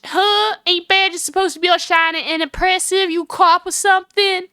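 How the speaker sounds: high, sceptical Southern drawl. Acted. Woman doubts the lawyer's badge, Southern drawl. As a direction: question it in a high Southern twang. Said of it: "I get to pull this voice off".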